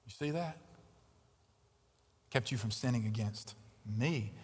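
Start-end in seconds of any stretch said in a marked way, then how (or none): 2.3-4.3 quiet. Shown as a demonstration. Preacher repeats God's words softly.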